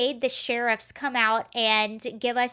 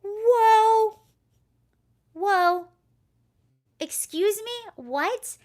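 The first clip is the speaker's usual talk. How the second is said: high, loud startled whoa; recreating her own disbelieving reaction to a rude stranger; shocked outburst; 9 semitones higher, 7 dB louder, much slower than usual. Retold or imitated. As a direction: retell the moment you were stunned, loudly. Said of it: "And I was like".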